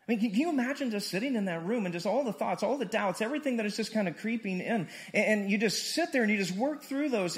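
rising sermon. Acted. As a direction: drive the questions home to the room. Preaching builds.

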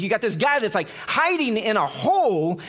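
ordinary talk

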